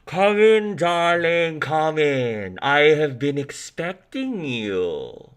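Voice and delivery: high, theatrical fortune-teller voice, slow at the end; mysterious psychic. Acted. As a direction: purr it out like a grand old-style psychic.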